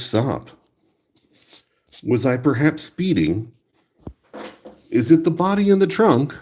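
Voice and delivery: overly courteous, a bit guilty; polite driver. Acted. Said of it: "If I get pulled over by the police".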